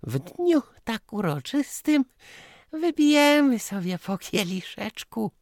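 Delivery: very high, loud aunt voice; high-pitched festive invitation; smiling aunt. A narrator's reading. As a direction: propose it high through a smile. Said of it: "uśmiechnęła się".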